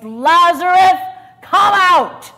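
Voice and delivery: big, high shouted command; loud command. Acted. Jesus commanding Lazarus from the tomb, shouting. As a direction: call the dead man out at full volume. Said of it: "a loud voice".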